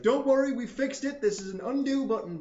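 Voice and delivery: high, loud mock announcement; mock reassurance. Gamer voicing an absurd zombie cure, mock-triumphant.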